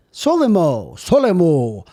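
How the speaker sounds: loud, hammed-up announcer voice for the brand name; odd product voice. Host presenting his energy drink, in a loud mock announcer voice. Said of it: "I don't know what that voice is".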